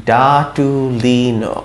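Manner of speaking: ডাটুলিনো slowly, all syllables elongated; slow sounding-out. Shown as a demonstration. Teacher repeating the word for learners, slow sounding-out. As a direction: draw out DAA-TUU-LEE-NOO. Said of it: "ও দেই আমি কিরকম হয়".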